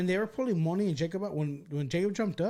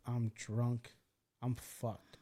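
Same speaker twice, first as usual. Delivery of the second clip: inner thought; 6 semitones lower, 8 dB quieter, much slower than usual. Retold or imitated. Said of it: "I'm thinking to myself".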